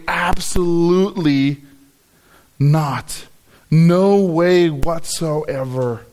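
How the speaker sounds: slow, separated "absolutely", "not", "No way whatsoever"; emphatic. Shown as a demonstration. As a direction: stretch each word, with a firm pause before and after "not". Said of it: "Again, the emphatic way".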